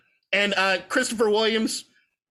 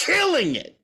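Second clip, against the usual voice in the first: excited praise; 8 semitones higher than usual. Real, not acted.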